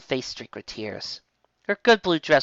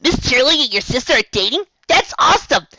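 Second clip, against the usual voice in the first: high, loud excited filly; thrilled surprise; 12 semitones higher, 7 dB louder than usual. A narrator's reading. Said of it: "reared back in surprise".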